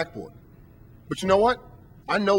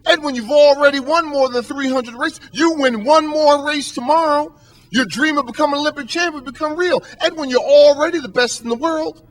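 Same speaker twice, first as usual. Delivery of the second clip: pitched-up exasperated coach, pressing hard; coach goes ballistic; 7 semitones higher, 4 dB louder than usual. Retold or imitated. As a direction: plead like a frantic coach who can't believe it. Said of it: "his coach goes ballistic".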